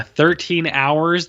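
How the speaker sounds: ordinary delivery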